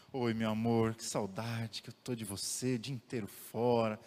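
husband greets his wife tenderly at night; sweet talk to wife. Pretend. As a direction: coo lovingly to your wife. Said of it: "Imagina se eu chegasse pra minha esposa".

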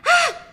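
short stifled scream, high and loud; a burst of scream bitten off; imitating a repressed yelp; suppressed scream. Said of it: "it's one of those".

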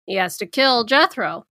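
normal talking voice

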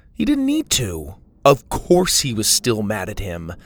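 usual speaking voice

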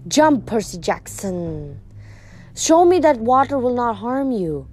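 slow, hissing snake lady voice; a slow menacing serpent woman; hissed. Acted. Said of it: "Echidna hissed".

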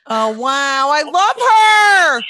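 loud, drawn-out gushing cry. Real, not acted. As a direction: swoon over her out loud. Gushing about guest's scientist mother.

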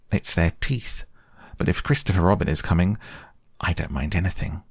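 usual speaking voice

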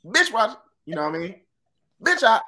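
high, loud flinching pimp; smooth flashy pimp swagger. Pretend. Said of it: "pimp name, why oughta".

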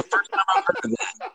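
high, laughing disbelief. Real, not acted. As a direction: talk through real laughter, pitched high. Friends laughing at obscure show.

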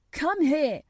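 high, loud command; shout. A narrator's reading. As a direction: shout the order urgently. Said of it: "he shouted to Dickon".

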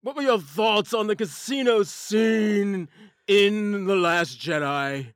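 slow, loud, gruff dwarf voice; Asgardian dwarf voice. Pretend. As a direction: ask it like a booming Asgardian dwarf. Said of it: "ask him a question in your Asgardian dwarf voice".

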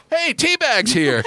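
high, drawn-out mock holler; calling out. Retold Hatchell calls out on arrival.

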